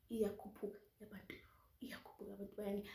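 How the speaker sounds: soft unvoiced whisper; hushed anxious aside. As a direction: breathe it nervously. Watching queue, whispered.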